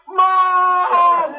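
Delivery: put-on high, loud child's cry for mom; a son with a bruised finger shouting for mom; child calling for mom. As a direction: yell it like a hurt little boy calling his mother. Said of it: "he had to call on mom".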